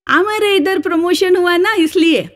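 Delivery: lightly voiced South Indian accent in Hindi, a bit high; a hint of a Madrasi colleague speaking Hindi. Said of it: "मद्रदेशीय महाभाग".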